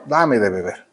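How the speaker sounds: soft, low, gentle asking; kind request. Retold or imitated. Jesus asking the Samaritan woman for water, gently. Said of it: "de una manera muy amable".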